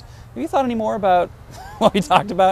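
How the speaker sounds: playfully imitating mom's probing tone, laughing mid-question; teasing impression of mom fishing for an answer; mom's pointed question. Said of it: "she goes".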